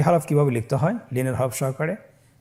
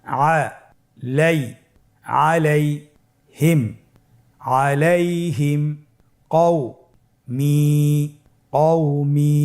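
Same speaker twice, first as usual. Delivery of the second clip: broken into syllables, then joined slowly; much slower than usual. Shown as a demonstration. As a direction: read it piece by piece for learners. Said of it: "আমার সাথে উচ্চারণ করুন". (ভেঙে ভেঙে ধীরে উচ্চারণ)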